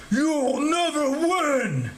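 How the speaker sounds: long, high-strained monster cry; drawn-out villain wail; giant's groan. A narrator's reading. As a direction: groan out defiance, dragging each word. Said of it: "the giant groaned".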